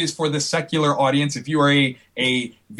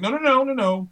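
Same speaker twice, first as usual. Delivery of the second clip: high, rapid-fire string of no's; head off an eager kid, pitch coming down; mock-alarmed stopping; urgent refusal; 10 semitones higher than usual. Retells stopping eager kids.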